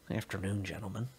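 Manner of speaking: a quiet threat dressed as politeness; cold officer. A narrator's reading. Officer's cold greeting, much quieter.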